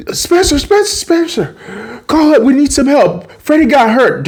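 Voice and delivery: very high, urgent calling; retold panicked plea. Sam bursts in on Spencer, panicked. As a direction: beg for help in mock panic.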